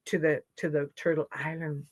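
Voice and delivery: plain reading voice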